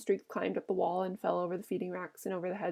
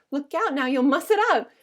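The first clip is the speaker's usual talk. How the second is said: alarmed warning; 5 semitones higher, 7 dB louder than usual. A narrator's reading. Wife warns Lennie off her hair.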